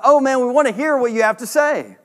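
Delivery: imitating eager churchgoers; eager villagers. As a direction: sound like a crowd keen to hear him. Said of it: "everybody's like".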